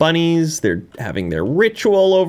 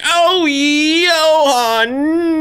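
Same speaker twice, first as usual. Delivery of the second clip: very slow, higher, stretched Johan; drawn-out lament; 11 semitones higher, 3 dB louder, much slower than usual. Real, not acted. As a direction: drag the name out in dismay. Johan caught by monster.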